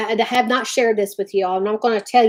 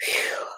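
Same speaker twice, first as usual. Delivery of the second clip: whispered exhale; 9 dB quieter than usual. Real, not acted. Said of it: "I got that out".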